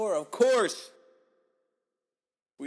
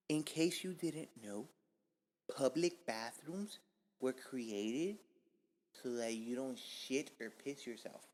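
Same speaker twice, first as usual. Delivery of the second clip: confidential explaining; 6 dB quieter, slower than usual. Real, not acted. After telling viewer get closer.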